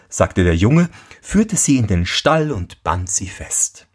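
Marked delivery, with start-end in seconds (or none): none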